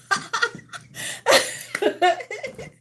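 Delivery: high, helpless laugh; long high giggle. Real, not acted. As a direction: laugh hard while winded. Laughing, winded after dancing.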